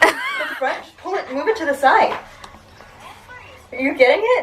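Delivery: high, laughing instructions. Real, not acted. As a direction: giggle while straining to explain. Laughing instructions mid-workout.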